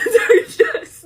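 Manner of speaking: real crying laugh rising high and loud; tears and laughter tangled, giving up on words. Real, not acted. Creator tries to explain pregnancy emotions, laugh-crying. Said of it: "every single time I start bawling".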